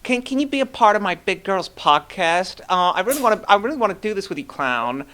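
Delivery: hammed-up needy fangirl begging; Hannah Butts. Host reading out her supposed messages, high mocking voice. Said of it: "missed messages from Hannah Butts saying".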